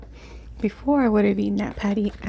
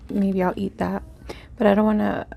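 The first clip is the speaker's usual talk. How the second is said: ordinary delivery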